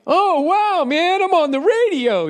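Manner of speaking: mock thrilled radio fan; amazed seventies partygoer. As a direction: gush like a stoned partygoer.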